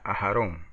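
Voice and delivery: Aarón said ha-RON with a light English h; a soft, airy h at the start of Aarón. Shown as a demonstration. Teacher drilling the Hebrew name, gentle breathy h. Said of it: "es una H inglesa, suavecita".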